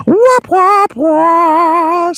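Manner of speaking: very high, loud imitated flute blasts; repeated high mock-flute squawks; screaming flute noise. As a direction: shriek like a piercing flute. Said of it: "screams this fucking flute in my ear".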